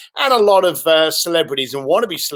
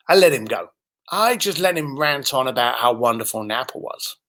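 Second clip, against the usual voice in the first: ordinary delivery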